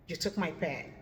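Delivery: "You took my pen." as a statement, pitch falling at the end. Shown as a demonstration. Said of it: "That was me using a falling tone".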